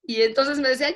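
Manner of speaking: usual speaking voice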